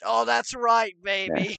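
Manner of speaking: hammed-up British catchphrase voice; Michael Myers. Myers cheering on Fassbender, hammed-up British spy parody. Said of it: "And Michael Myers goes".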